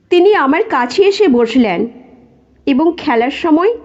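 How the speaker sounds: ordinary delivery, nothing marked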